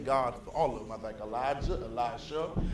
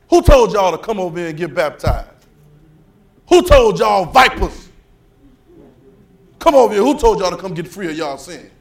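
angry prophet's rebuke; 4 semitones higher, 14 dB louder than usual. Acted. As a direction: thunder at the crowd like John the Baptist. John the Baptist rebuking the crowd.

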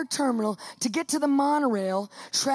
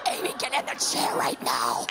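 very low, forceful order; hammed-up commanding growl; 12 semitones lower than usual. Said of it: "And I command her".

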